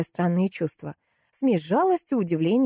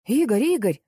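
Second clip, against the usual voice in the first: higher-pitched call of a name; 5 semitones higher, 3 dB louder than usual. A narrator's reading. Coworker calls to the butcher, crying out. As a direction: hail someone from the side. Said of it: "закричали сбоку".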